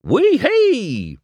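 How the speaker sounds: high, loud whoop of joy; excited shout. A narrator's reading. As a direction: whoop it out to the morning. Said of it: "Mater shouted".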